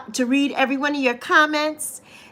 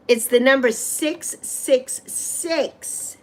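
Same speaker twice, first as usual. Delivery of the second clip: slow mystic reading; much slower than usual. Acted.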